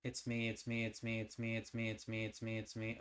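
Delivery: steady, even storytelling read; story narration. A narrator's reading. Reading the game's story aloud to viewers, steady narration.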